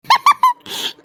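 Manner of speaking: high-pitched mocking giggle; mocking laugh. Sister-in-law laughing at the gold-chain habit, high mocking giggle. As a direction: giggle scornfully to yourself. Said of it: "எகத்தாளமா சிரிக்க போல".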